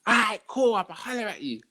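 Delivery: keen to buy again; lightly voiced customer. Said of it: "And he's like".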